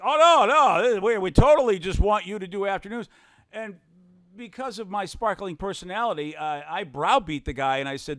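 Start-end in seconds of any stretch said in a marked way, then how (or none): 0.0-3.1 mock salesman. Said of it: "the carpet salesman is like".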